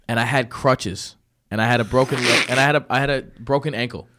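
ordinary talk

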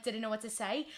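ordinary talk

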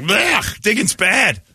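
high squeaky disgusted dog. Retold or imitated. Puppy recoiling from poop in hole, high squeaky voice. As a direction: give the dog a high, grossed-out little voice.

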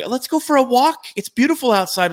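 high-pitched imitation of partner; girlfriend voice. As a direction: mimic her sweet invite. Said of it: "the love of my life".

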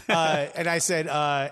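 normal talking voice

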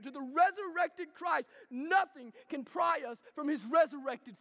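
forceful pulpit proclamation, building in waves; impassioned preaching. Acted. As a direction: preach it hard, hammering each key phrase.

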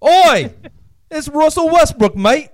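put-on very high, loud shouted English accent; badly faked English accent, somewhere near Australian; bad English announcer. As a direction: holler it like an English hooligan announcer. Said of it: "we need an English announcer".

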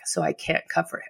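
fighting back real tears; choked up. Real, not acted. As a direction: voice catching, near tears. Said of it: "It still chokes me up".

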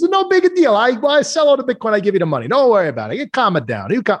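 high, easygoing Italian impression; mock Italian reassurance; relaxed Italian voice. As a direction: brush him off with a high, breezy Italian lilt. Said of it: "he was Italian".

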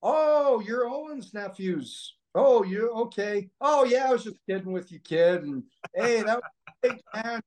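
mock the bully turning sweet once he learns whose nephews they are; Barry backpedalling after meeting Owen's nephews; fake-friendly Barry. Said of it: "He goes".

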